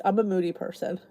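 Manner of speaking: ordinary talk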